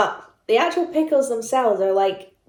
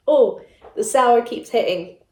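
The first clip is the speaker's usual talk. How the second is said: ordinary talk, nothing marked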